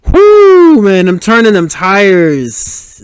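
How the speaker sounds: a high whoop that settles into slow, drawn-out awe; real delight at the drift; excited whoop. Real, not acted. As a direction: cheer the drift out loud. Watching a car drift scene.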